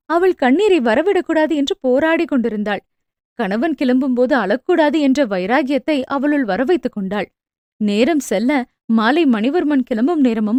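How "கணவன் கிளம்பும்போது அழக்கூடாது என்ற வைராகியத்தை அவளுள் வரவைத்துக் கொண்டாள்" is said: usual speaking voice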